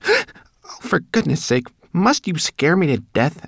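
high, loud, flustered rat; startled scolding. A narrator's reading. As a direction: scold as you recover from a fright. Rat scolds Sorrel for startling her.

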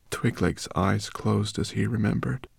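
usual speaking voice

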